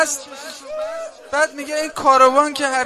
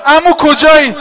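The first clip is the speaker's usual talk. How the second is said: put-on loud lost child's cry for uncle; loud wailing call of a lost child; 10 dB louder, slower than usual.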